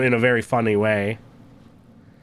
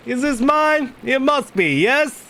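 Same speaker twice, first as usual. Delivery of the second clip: high-pitched, demanding nobleman; imperious visiting lord; 11 semitones higher, 3 dB louder, slower than usual. Acted. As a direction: question the prior in a high, lordly voice.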